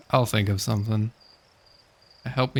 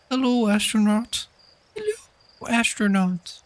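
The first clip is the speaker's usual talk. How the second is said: put-on much higher, bright greeting from a little kid; high child voice; 11 semitones higher than usual. Game kid calling out hello to the astronaut, high child voice. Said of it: "I don't know why I can't do the voice".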